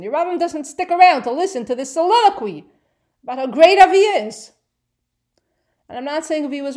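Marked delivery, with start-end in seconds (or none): 0.0-4.5 emphatic. Real, not acted. Teacher mocking the preachy speech.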